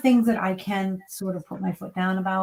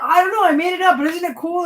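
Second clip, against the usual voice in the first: higher and louder, pleased with himself; proud and giddy; 9 semitones higher, 7 dB louder, faster than usual. Retold or imitated. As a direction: sell your made-up idea like a kid. Wife voices husband's proud answer.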